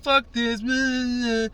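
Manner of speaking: high, mock-drunk grumbling; drunk friend. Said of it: "my fucking friend was just being hella drunk".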